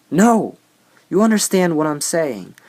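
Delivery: loud, high exasperated rant. Real, not acted. Ranting at the barista, loud and exasperated. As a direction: vent it hard. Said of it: "ranting at barista".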